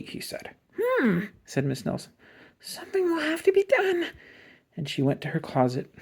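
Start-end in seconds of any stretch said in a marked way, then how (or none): 0.8-4.1 very high, scratchy, plotting. A narrator's reading. Miss Nelson decides to act, in a scratchy plotting voice. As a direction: rasp it like a schemer.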